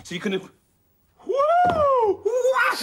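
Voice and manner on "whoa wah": high, loud whoop of alarm; long startled yell; shocked scream. Acted. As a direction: cry out in total astonishment. Shock at seeing two dogs.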